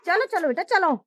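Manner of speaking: normal talking voice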